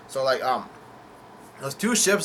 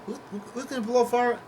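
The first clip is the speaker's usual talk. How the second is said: confused surprise; 10 semitones higher than usual. Real, not acted. Confused by the line he retells.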